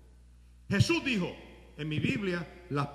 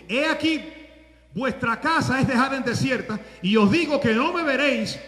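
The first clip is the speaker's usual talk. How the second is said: loud proclamation; 5 semitones higher, 9 dB louder than usual. Acted. Preacher proclaims Jesus' words.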